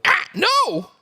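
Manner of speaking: high, loud yelp of dismay; surprised cry. Real, not acted. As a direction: cry out in embarrassed horror. Reacting to embarrassing clip mention.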